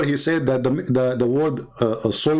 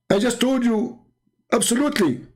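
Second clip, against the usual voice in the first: high-pitched imitation of an annoyed man; mock exasperation; 10 semitones higher than usual. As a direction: act out an opponent losing patience, pitched up. Retold exasperated opponent insisting.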